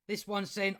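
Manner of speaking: ordinary talk, nothing marked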